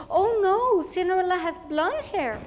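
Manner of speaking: a teacher fussing over a wrong picture; correcting teacher. Retold or imitated. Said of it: "she come up to me and she said".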